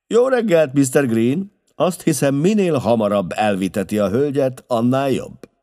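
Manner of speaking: high, loud welcome; hearty greeting. A narrator's reading. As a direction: call out briskly to a newcomer. Holmes hails arriving Mr Green.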